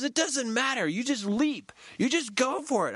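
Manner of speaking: high encouraging urge; encouraging facilitator. Retold or imitated. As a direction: push him to just leap. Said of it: "She goes".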